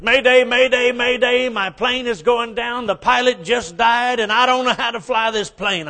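high, loud panicky mayday call; frantic blonde pilot on the radio; panicked pilot. Acted. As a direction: plead for help as the plane goes down.

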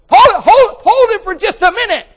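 very high, loud shout to stop him; salesman shouting. Acted. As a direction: yell at the driver through the window. Salesman stops the buyer.

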